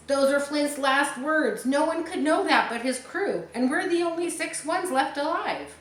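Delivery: frightened pirate explaining to his crew; terrified pirate. Acted. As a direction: plead it like a man sure the ghost is real. Terrified pirate after ghost's voice.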